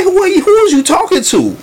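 very high-pitched, incredulous challenge; indignant retort. Acted. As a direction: act out an offended comeback, voice shooting way up. Acting out his own indignant retort.

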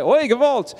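big swooping outburst; emphatic exclamation. Shown as a demonstration. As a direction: exclaim it high, like a Yiddish lament. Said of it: "say it like".

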